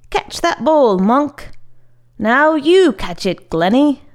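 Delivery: put-on bossy boy. A narrator's reading. Sho announces his powers to two friends, bossy.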